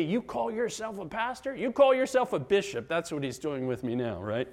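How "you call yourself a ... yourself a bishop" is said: high-pitched mock-incredulous challenge; sneaky accuser. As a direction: play the accuser needling a pastor. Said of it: "He gets sneaky with me".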